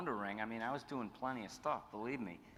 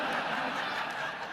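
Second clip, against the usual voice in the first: breathy laugh; 7 dB louder than usual. Real, not acted. Laugh after retold line.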